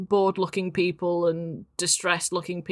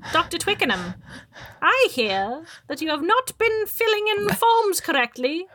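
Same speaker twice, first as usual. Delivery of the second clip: high, stern witch voice; witchy voice; 11 semitones higher than usual. Acted. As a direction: scold like a fussy witch.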